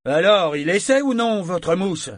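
high, loud demanding question; calling out. Acted. Trainer asks the sailors whether their boy will try, calling out. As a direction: press them for an answer loudly.

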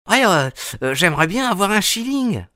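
put-on a cheeky six-year-old thinking it over; a little boy asking a stranger for a shilling; small boy. A narrator's reading. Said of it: "répondit-il".

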